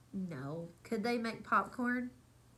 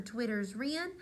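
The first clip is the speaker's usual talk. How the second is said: lively storybook read-aloud; reading to children; 4 dB louder, slower than usual. A narrator's reading. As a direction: read it like bedtime story time. Reads picture book aloud.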